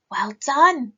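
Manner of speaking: usual speaking voice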